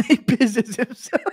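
high-pitched, laughing all through; helpless laughter. Real, not acted. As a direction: collapse into giggles on the words. Cracking up at imagined scene.

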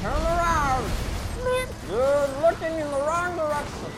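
high, loud taunting yells; hammed-up threats at the screen; manic yelling. Gamer taunting zombies, high manic yell.

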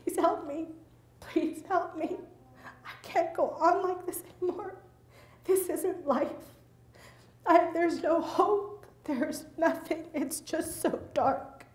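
high, fragile plea, as if near tears; reliving a hopeless, high-pitched prayer for help; desperate begging. Retold or imitated. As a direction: plead from the depths of despair. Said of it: "just begging".

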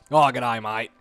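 lightly put-on Australian accent; mock Australian accent. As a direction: play at being a laid-back Aussie. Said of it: "I want that accent".

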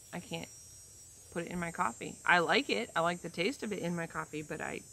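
ordinary talk

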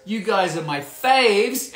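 loud, slow gushing fan voice; all caps gush. Shown as a demonstration. Reading the fan's all-caps line, loud and slow. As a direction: belt it out like a superfan. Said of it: "exclamation mark, all in caps".

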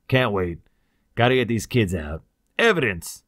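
loud, firm, short phrases; curt refusal. Acted. Cloud refuses to wait for backup, curt and firm. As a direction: overrule the objection decisively.

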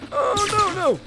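very high worried cry; alarmed squeal. Real, not acted. Player in trouble in the game, alarmed. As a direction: squeal in sudden dismay.

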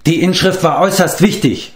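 high-pitched, indignant reproach; reproachful. A narrator's reading. Eilonwy blames the bard, reproachful. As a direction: take him to task. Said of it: "warf sie ihm vor".

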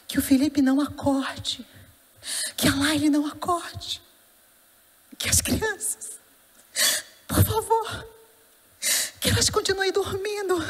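choked plea, rising close to crying; tearful pleading prayer. Retold or imitated. Mother begging God that the children stay asleep, tearful plea. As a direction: voice climbing high, breaking up with long pauses.

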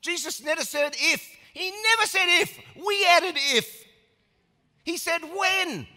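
high, loud climax on never and if; rising preacher shout. Real, not acted. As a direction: build the fire and hammer each word.

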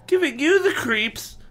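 put-on high, slow, incredulous boy's cry; high hammed-up boy's outcry; Evan crying out. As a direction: squeak it out in disbelief. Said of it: "Evan cried".

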